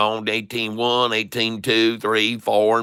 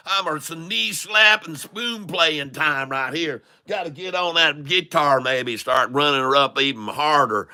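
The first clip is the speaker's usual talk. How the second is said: put-on higher-pitched hollering country boy; 4 semitones higher than usual. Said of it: "switched over to the redneck".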